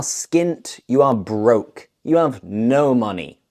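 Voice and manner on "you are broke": normal talking voice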